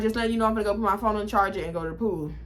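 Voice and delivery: fast breezy rattled-off line; rushed check-in. Retold or imitated. Daughter quickly telling mom she's off to the pool, rushed. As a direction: rush it so mom doesn't ask.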